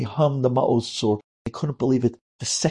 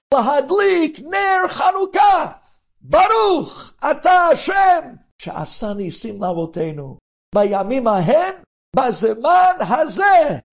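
high, loud, slow shouted prayer; screamed blessing; 5 dB louder than usual. A narrator's reading. Boy screams the Hanukkah blessing alone at the window. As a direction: cry out the blessing defiantly. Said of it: "And he screams".

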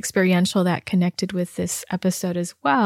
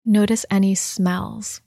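guided meditation. Acted. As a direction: gently lead the listener inward, leaving space between phrases. Leads a guided body scan meditation.

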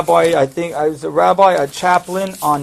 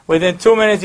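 ordinary talk

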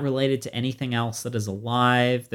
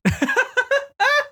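high laugh; 3 dB louder than usual. Real, not acted. Laughing at own bear yell.

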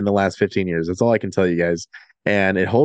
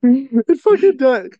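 excited; 5 dB louder, slower than usual. Real, not acted. Laughing agreement.

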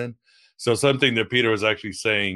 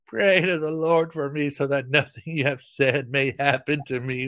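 higher, begging plea; pleading Simon; 6 semitones higher than usual. Acted. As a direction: beg like a frightened man. Reading Simon's plea aloud.

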